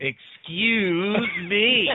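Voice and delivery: hammed-up indignation, stretched right out; mock indignant catchphrase. Friend quoting a Zelda line, mock indignant.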